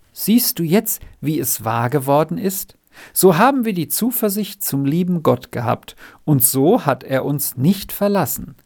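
plain reading voice

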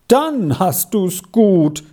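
high, drawn-out envious exclamation; exclaiming. A narrator's reading. Girl envies boy who never practises, exclaiming. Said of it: "rief Alida aus".

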